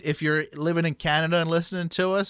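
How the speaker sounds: ordinary talk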